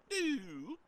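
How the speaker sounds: a high electronic "Doo" like a dying camera; imitated beep. Telling chat the camera died, a high "Doo".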